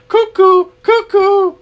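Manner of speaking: mock the doubter with a high cuckoo call; mocking skeptic. Skeptic mocking the believer, very high sing-song cuckoo. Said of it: "some people will look at you funny".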